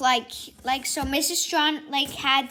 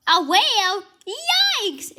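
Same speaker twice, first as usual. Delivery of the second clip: very high, loud yelp of fright; villain spotting a whale, shrieking in fright; startled shriek; 7 semitones higher, 8 dB louder, slower than usual. Acted. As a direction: squeal at the sight of it. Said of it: "and then he's like".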